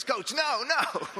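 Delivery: put-on high panicked little boy; pleading kid. Little guy begging the coach to sit him out, high pleading. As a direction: beg like a scared ten-year-old.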